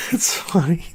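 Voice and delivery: breathy whisper after laughing; whispered amused remark. Real, not acted. Amused aside to viewers about the joke, whispered.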